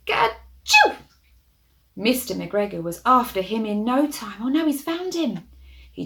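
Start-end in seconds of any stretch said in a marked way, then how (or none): 0.1-1.0 big hammed-up sneeze, high and loud; loud cartoon sneeze. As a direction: burst out a comic high sneeze. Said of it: "Peter sneezed".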